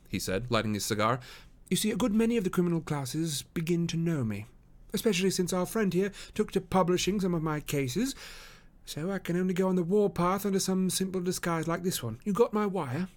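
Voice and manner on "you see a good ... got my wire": high, even voice for Holmes; composed detective. Acted. Holmes explaining his disguise to Jones, composed. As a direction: explain coolly in a raised pitch.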